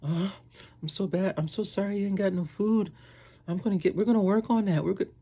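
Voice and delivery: high, drawn-in pity voice; put-on high voice full of concern; sympathetic promiser. Someone promising food to the hungry, high mock sympathy.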